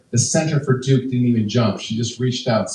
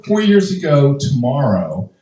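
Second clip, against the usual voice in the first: normal talking voice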